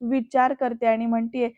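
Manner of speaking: plain reading voice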